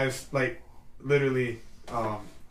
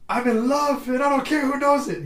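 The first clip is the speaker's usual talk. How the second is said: high, loud lovestruck proclamation; mock ecstatic shout; 11 semitones higher, 7 dB louder than usual. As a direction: announce love to the world. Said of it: "he's like".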